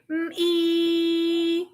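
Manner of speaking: "Mm-ee" stretched out and lifted; long sing-song "Mm-ee"; teaching sound. Shown as a demonstration. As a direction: coax the toddler to copy "Mm-ee".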